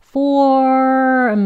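"form" stretched slowly through the "or"; loud, elongated "form" with clear r; r-coloured vowel. Shown as a demonstration. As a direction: hold the r in "form" long. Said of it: "or, or로 끝나는 거예요".

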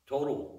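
Total punched high and loud, then falling; emphatic Total. Real, not acted. Telling the congregation the final cost, Total punched loud. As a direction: hit the word Total hard to open the sum.

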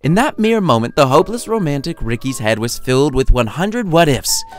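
usual speaking voice

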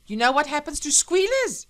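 loud, high threatening question; menacing warning. Acted. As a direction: intimidate the boy into silence. Woman threatens boy witness.

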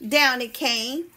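ordinary delivery

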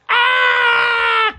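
a high, wild cheer like a roaring audience; mock crowd roar. Comic retelling a crowd going wild, mock cheering. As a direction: howl like the whole arena going nuts.

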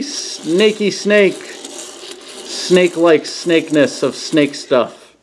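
slow, drawn-out goofy voice; silly snake voice. Pretend. Reviewer showing the snake piece, slow goofy voice. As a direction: ham up the snake part.

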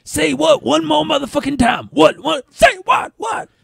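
very high, shouted movie pimp impression; high raspy mock shouting; Pinky. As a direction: yell it as a hammed-up, squealing threat. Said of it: "he's just like Pinky from Next Friday".

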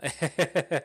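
short bright burst of laughter; a quick chuckle at the memory; real amused laugh. Real, not acted. Laughing at clients' mistake.

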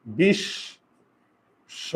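বিশ্ব drawn out slowly as "bish-sho"; doubled consonant demo. Shown as a demonstration. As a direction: split বিশ্ব in two, holding the sh. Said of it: "ওই বর্ণ দুইবার উচ্চারণ হয়".

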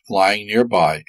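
usual speaking voice

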